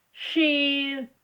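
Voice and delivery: ordinary talk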